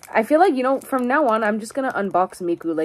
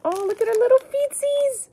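put-on high cooing baby voice; baby talk; 3 dB louder than usual. Gushing over the doll's tiny feet in high baby talk. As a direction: coo at the doll like a baby.

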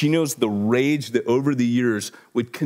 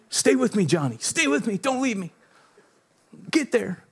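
high, hammed-up deathbed pleading; mock desperate plea; 8 semitones higher, 3 dB quieter than usual. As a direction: beg like a film buddy over the wounded, hammed up. Mock plea to a fading audience.

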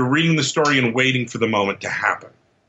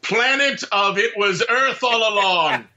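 mock movie title; 8 semitones higher than usual.

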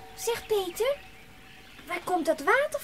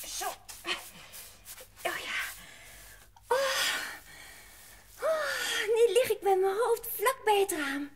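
ordinary delivery, nothing marked

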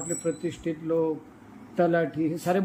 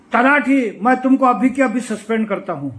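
raised, higher-pitched official's bellow; retold angry shout; 4 semitones higher, 6 dB louder than usual. SDO threatening the talathi with suspension, retold shout. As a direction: re-enact an officer loudly threatening his clerk. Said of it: "जोरानं ओरडला".

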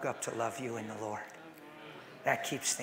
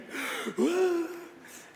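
gasp; 14 semitones higher than usual. Retold or imitated. Son overcome at the door.

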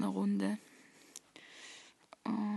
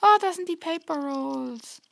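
surprised; 6 semitones higher, 7 dB louder than usual. Real, not acted. Surprised discovery.

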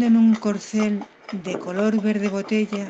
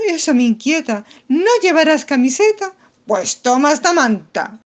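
fussing maiden; 6 semitones higher, 7 dB louder than usual. Acted. Pepa fusses over him.

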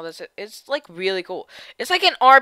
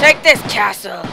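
gloating shout; 9 dB louder than usual. Acted. Taunting the castle.

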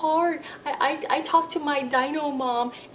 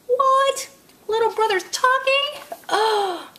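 much higher, surprised puppet cry; 10 semitones higher than usual. Pretend. Dinosaur puppet amazed that the baby talks, surprised. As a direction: gasp out the surprise.

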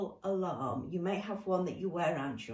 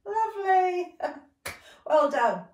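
breathless laughing praise; 9 semitones higher, 6 dB louder than usual. Real, not acted. After laughing hard, praising.

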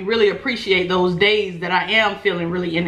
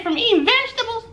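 incredulous; faster than usual. Retold or imitated. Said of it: "I said".

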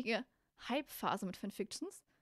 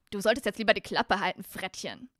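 Ron; 9 dB louder than usual. Acted. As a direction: warn him off loudly, dropping away on the last word. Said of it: "sorry, das war Ron".

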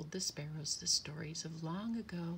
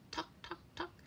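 soft, voiceless tocks; quiet little knocking sound; egg tapping; 7 dB quieter than usual. A narrator's reading. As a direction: imitate tiny taps from inside a shell. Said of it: "went one of the eggs".

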